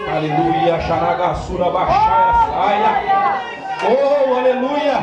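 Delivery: loud, high ecstatic tongues; speaking in tongues. Real, not acted. Preacher praying in tongues at the climax, loud and high. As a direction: burst out in high loud glossolalia.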